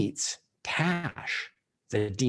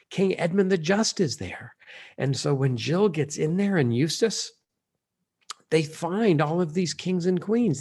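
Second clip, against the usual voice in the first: ordinary delivery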